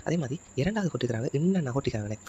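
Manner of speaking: usual speaking voice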